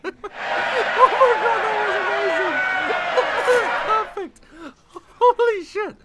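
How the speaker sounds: loud, very high squeals through laughter; real hysterical laughter. Real, not acted. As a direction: squeal it while helpless with laughter. Still cracking up at answer.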